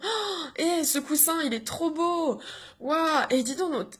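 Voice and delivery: put-on high, delighted voice of a five-year-old; wide-eyed child, thrilled by cats and a dog; excited little child. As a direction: gush over everything like a small kid visiting. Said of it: "Il va se dire".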